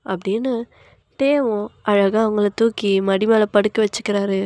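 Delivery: ordinary delivery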